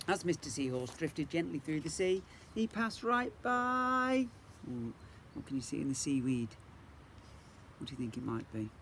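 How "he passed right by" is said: higher, drawn out slowly; sing-song stretched refrain. A narrator's reading. Reading to a child, slow high refrain.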